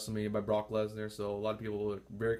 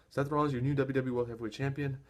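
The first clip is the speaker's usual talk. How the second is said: ordinary delivery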